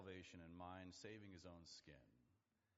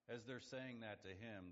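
ordinary delivery

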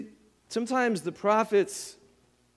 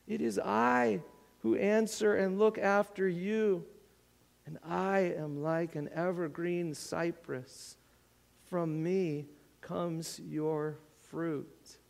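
tender plea; 6 dB quieter than usual. Acted. Preacher voicing the Lord's tender plea, softer. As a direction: say it gently, as a hurt friend. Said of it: "Do you hear the personal thing".

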